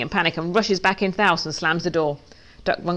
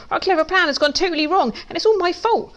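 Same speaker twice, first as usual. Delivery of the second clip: high-pitched little wail; despairing; 11 semitones higher than usual. A narrator's reading. Pig realising the plan has failed, despairing. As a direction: voice climbing in mild despair.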